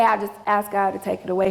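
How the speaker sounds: fast, throwaway request; quick offhand ask. Shown as a demonstration. Preacher showing what Paul did not say, quick and casual. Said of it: "He didn't say".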